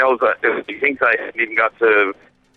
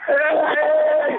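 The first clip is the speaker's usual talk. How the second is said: excited greeting; 13 semitones higher than usual. Real, not acted. Calling out to children.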